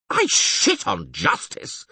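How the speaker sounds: high, slow, furious outburst; yelled. Acted. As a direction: spit the words out in rage. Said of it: "yelled the mayor".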